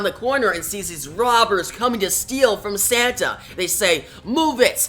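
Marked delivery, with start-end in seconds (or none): 4.2-4.9 higher-pitched mock command; retelling a robber's urgent demand; retold robber's order. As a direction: order him along like a hold-up man, without shouting. Robbers order Santa at a holdup.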